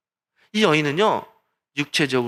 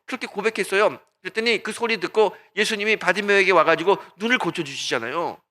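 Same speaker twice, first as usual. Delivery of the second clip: usual speaking voice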